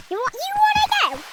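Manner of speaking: squeaky high mock tough-guy challenge; high comic squeak of a fight offer. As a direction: squeak out a pretend threat. Picking a fight in game.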